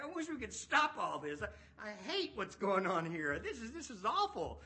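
high, loud, fast and frantic complaining; exasperated Pharisee. Acted. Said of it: "this is kinda how they feel".

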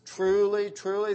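preacher stressing "truly" to grip the congregation; emphatic truly truly. Shown as a demonstration. Preacher hammering home "truly, truly" with emphasis. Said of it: "Added for emphasis".

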